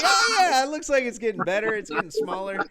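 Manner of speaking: high and loud, mock reassuring wife. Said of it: "And she's like".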